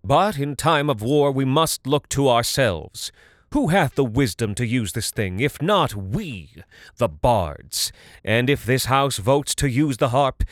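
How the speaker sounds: persuasive speech. Acted. Talarn's speech to the house.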